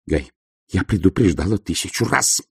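usual speaking voice

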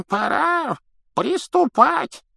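very high, slow, creaky elder; creaky old man. A narrator's reading. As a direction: squeak it out like an ancient official. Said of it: "раздался скрипучий голос старца".

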